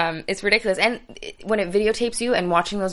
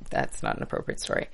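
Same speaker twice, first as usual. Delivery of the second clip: hushed aside; 7 dB quieter than usual. Real, not acted. Cuts off a risqué story.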